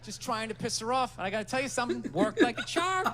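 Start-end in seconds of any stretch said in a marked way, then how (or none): 2.1-3.1 laughing through the line. Real, not acted. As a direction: laugh as you say it. First take, laughing.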